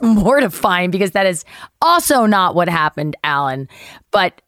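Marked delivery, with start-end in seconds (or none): none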